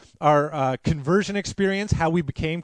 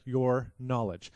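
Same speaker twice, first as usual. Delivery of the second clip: softer, deliberate emphasis on YOUR; stress on YOUR; 4 semitones lower, 6 dB quieter, much slower than usual. Real, not acted. Preacher repeating the key point, YOUR gently stressed. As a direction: lean gently on the word your. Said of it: "Now, I say "your," again".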